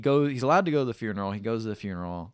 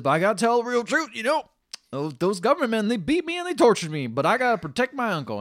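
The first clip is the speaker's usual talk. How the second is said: raised, earnest plea; husband swearing to protect his uncle, raised and pleading; higher pleading man; 11 semitones higher, 7 dB louder than usual. Retold or imitated. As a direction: plead it loud and high. Voicing Mollie's husband.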